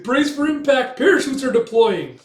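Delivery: high, loud alarm call; urgent warning shout. Retold or imitated. Crewman as the pod comes down, urgent warning shout. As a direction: shout it over a crashing craft.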